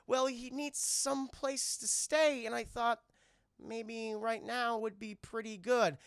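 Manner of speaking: sheepish. Retold or imitated. Slow sheepish kid.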